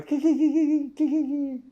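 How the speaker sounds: high, loud mock car engine failing to start; mimicking the car that won't start; imitated engine noise. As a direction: sound out a stalling starter motor, high and loud. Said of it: "And I hear it go".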